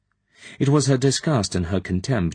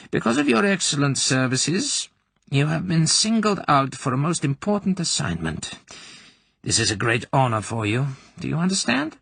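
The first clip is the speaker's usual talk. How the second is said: much higher, formal officer voice; Colonel Klebb voice; 8 semitones higher than usual. A narrator's reading. Colonel giving the corporal an assignment, formal. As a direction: announce the honour like an official.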